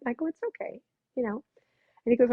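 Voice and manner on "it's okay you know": soft reassurance. Retold or imitated. Comforting guest before unmasking.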